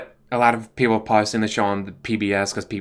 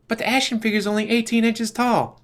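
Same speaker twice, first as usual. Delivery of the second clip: high sheepish excuse; actor's excuse; 12 semitones higher than usual. Retold or imitated. Actor on his cell phone, making a high, sheepish excuse. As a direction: squeak out a lame excuse. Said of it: "is all you need to see".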